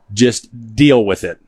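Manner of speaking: slow, firm put-down; blunt. Retold or imitated. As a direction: lay it down slowly and flatly. Telling mom to get over it.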